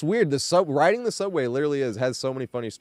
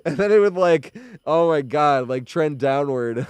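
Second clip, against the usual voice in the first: normal talking voice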